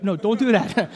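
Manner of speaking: ordinary talk, nothing marked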